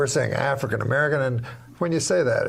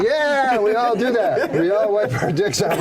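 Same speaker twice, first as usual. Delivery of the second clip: crowd voice; 7 semitones higher than usual. Retold or imitated. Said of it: "everyone in the audience is like".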